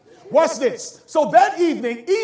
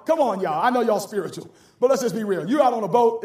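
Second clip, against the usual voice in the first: normal talking voice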